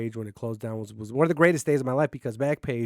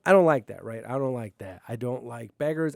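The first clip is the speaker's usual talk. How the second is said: ordinary talk, nothing marked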